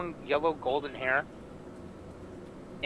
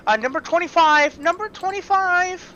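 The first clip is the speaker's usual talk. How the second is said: much higher and louder, calling over the crowd; calling out numbers; 10 dB louder than usual. Acted. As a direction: call the next ticket across the shop. Baker calling next number.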